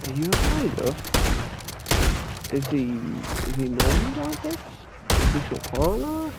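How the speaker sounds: puzzled concern, pitch climbing at each question. Retold or imitated. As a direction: check on her with lifted pitch. Retold concerned questions to the woman.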